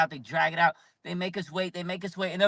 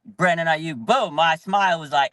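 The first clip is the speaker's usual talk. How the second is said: usual speaking voice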